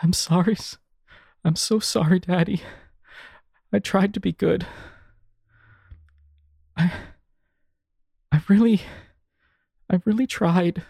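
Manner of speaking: high, choked, tearful pleading; tearful whisper. A narrator's reading. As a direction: whisper it through tears. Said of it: "she whispers under her breath".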